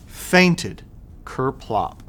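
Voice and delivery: usual speaking voice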